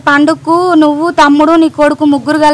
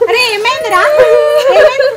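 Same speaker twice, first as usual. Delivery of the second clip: alarmed; 7 semitones higher, faster than usual. Acted. Neighbor alarmed by crying.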